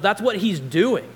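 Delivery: loud, high emphatic burst; emphatic exclamation. Real, not acted. Preacher points out the irony to congregation, emphatic exclamation.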